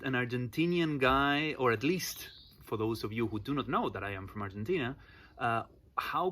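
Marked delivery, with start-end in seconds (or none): none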